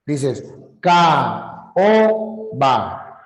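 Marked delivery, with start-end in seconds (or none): none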